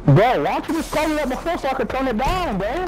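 exasperated yelling. Real, not acted. Arguing back at teammate, high pitch.

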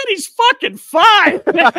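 high, loud and slowed, every word punched; emphatic retort. Retold or imitated. Teammate retold at the team table, a loud slow disbelieving retort. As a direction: fire back at the kid's doubter in disbelief.

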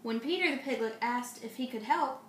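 plain reading voice

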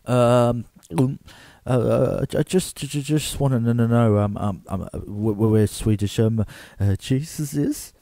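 tongue-tied, tripping over every word; flustered stammer. Acted. Interviewer asking a flirty woman about Swedish Jesus, flustered stammer. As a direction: stutter nervously, with lots of ums.

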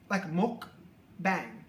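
"muk" then "bang", split apart and falling; careful mukbang. Shown as a demonstration. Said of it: "enunciate properly".

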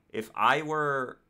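ordinary talk, nothing marked

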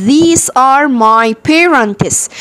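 very slow, carefully stretched English; نطق بطيء جدًا. Shown as a demonstration. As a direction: stretch every word for beginners. Teacher models English sentence. (قراءة إنجليزي بطيئة جدًا)

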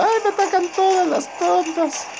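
very high whiny falsetto complaint; mock whiny crying. Gamer mimicking himself whining about being ganged up, squeaky whine. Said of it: "ando llorando".

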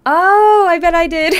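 high, loud excited squeal; surprised squeal. Real, not acted. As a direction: squeal it out in delighted shock. Realizes raid added subathon time.

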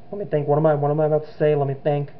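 ordinary talk, nothing marked

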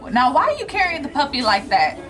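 ordinary delivery

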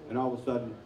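ordinary talk

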